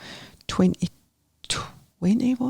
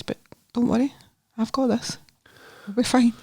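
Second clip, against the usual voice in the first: higher, gentle reassuring voice, slightly softer; 6 semitones higher, 3 dB quieter than usual. Retold or imitated. Mother to her son before an operation, reassuring. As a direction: soothe lightly, pitched up.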